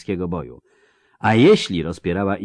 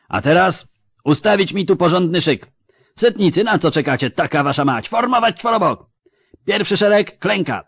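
high, loud commands snapped at soldiers; barked drill orders; 10 semitones higher, 7 dB louder than usual. Acted. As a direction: drill them like a furious sergeant. (wojewoda wykrzykuje rozkazy do szeregów)

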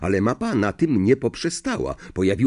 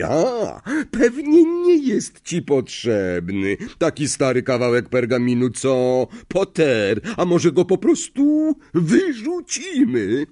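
higher, sly, teasing professor; baiting professor; 7 semitones higher, 4 dB louder than usual. A narrator's reading. Professor testing a pupil's lie, slyly baiting. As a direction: dangle the threat with mock innocence.

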